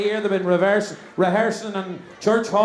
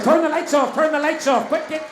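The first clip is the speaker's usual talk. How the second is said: higher, hurried call to the crew; urgent call; 6 semitones higher than usual. Pretend. Host to crew as the audience wants dark, urgent call.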